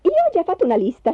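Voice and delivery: quick, high interjection; high, hurried wife's voice; eagerly jumping in. A narrator's reading. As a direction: jump in fast and eager. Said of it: "saltò su Magda".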